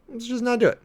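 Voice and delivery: high, emphatic exasperated plea. Real, not acted. Host begging viewers to stop, exasperated and high. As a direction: plead it high like you're fed up. Pleading repeat, pitch jumps.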